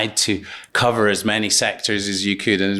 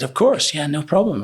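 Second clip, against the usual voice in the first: bright, pitched-up instant agreement; eager yes man; 7 semitones higher than usual. Retold or imitated. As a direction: leap in high and keen to please. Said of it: "I was just the forever yes man".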